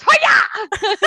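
short mock judo yell; mock karate shout. Friend retelling the judo flip, a mock karate shout. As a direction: bark it like a martial arts cry. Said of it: "she goes".

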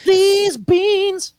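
high-pitched cartoon outburst; sudden high cartoon voice; Family Guy quote. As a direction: blurt it high like a cartoon character. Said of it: "It's a Family Guy reference".

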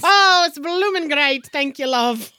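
a touch of an Irish lilt, playful; hint of Irish. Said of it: "can he have an Irish accent".

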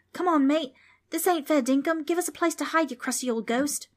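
put-on high-pitched Australian boy pleading; Australian accent. A narrator's reading. Boy demands hiding place from ghost, Australian accent. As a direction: argue loudly in a broad Australian accent.

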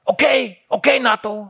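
high, insistent heckle; imitating the brother's goading, pitched well up; storebror's taunting. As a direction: keep pestering the guest in a high voice. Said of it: "Og storebroren sidder og siger".

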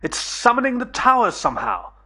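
high-pitched call over a roar. Acted. Narrator explaining to Billy above the monster's roar, calling out high. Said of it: "raising my voice above the roar of the monster".

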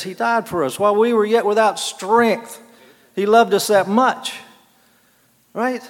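preacher building on Christ's love; fervent preaching. Real, not acted. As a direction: press each phrase home to the congregation. Preaches with rising fervour.

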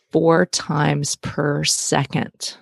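drawn out very slowly, word by word; super slow. Shown as a demonstration. Host repeating crazy information for listeners, super slow. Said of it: "I'm gonna say this super slow".

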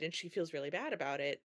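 ordinary talk, nothing marked

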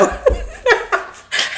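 high unvoiced burst of laughter. Real, not acted. Laughing at sister's retort.

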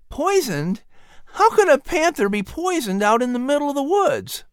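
put-on high boy's voice, questioning; a boy baffled by the news; puzzled Patrick. A narrator's reading.

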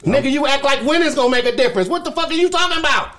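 high-pitched, incredulous outburst; heated disbelief. Real, not acted. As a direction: fire it back way up high, exasperated. Heated retort about winning not mattering.

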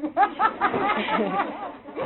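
loud, high burst of laughter. Real, not acted. Laughter after calling him scary. (פרץ צחוק רם)

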